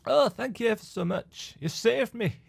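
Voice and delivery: a hint of a playful pixie, reading the quest text; lightly voiced pixie. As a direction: tease it like a mischievous fairy. Voicing pixie NPC dialogue.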